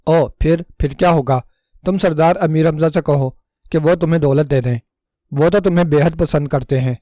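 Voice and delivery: plain reading voice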